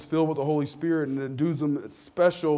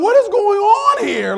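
much higher and louder, slow astonished shout; shouted disbelief; 13 dB louder, slower than usual. Retold or imitated. Listener stunned by the preacher's change, astonished shout. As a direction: yell it like you can't believe your eyes. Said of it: "You're like".

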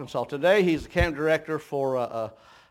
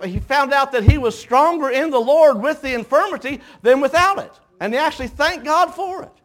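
much higher and louder, driving preacher cadence; preaching at full force; 11 semitones higher, 9 dB louder than usual. Acted. Preacher rousing the congregation about battles, full-force preaching. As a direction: build it into a rousing sermon climb.

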